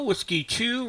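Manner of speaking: normal talking voice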